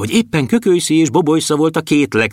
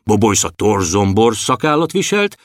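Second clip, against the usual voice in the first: plain reading voice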